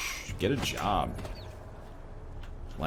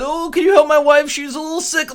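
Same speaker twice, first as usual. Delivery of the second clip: very high, loud put-on plea; hammed-up high-pitched imitation; mock pleading character; 8 dB louder than usual. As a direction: squeal it as a silly helpless husband. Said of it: "Look at these guys".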